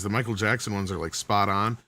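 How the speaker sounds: ordinary talk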